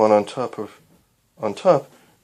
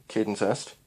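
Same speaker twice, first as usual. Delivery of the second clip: usual speaking voice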